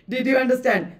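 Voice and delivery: ordinary delivery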